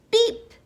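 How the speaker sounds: short high beep; a bright-pitched, punchy truck horn; little truck beep. A narrator's reading. Narrator voicing Blue the truck's greeting, loud high beep. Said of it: "said Blue".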